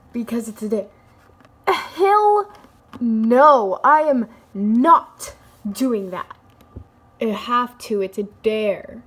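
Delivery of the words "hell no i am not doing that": loud, firm refusal; flatly refuse, loudly. Acted.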